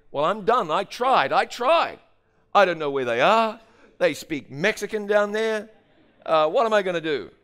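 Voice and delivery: loud, high-pitched mock despair; exasperated. Caller giving up after the failed phone call, exasperated. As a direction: throw up your hands, loudly.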